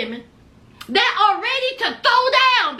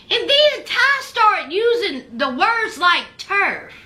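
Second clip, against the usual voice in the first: usual speaking voice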